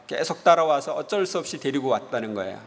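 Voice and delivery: ordinary talk, nothing marked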